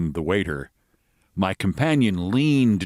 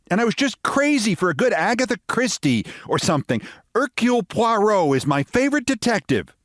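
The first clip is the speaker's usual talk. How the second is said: American lady voice; 12 semitones higher than usual. Acted.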